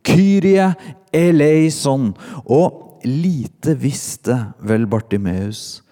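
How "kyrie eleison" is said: loud, high Greek cry. A narrator's reading. Beggar's cry given in Greek, called out loudly. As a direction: shout it as a raised plea to God. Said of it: "det Bartimeus ropte denne dagen".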